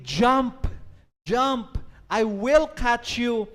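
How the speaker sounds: loud, pressing call to jump; urging father. Acted. Father urges his daughter to jump into his arms, loud. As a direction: urge the child to leap, firm and loud. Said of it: "she was trying to say to her daughter".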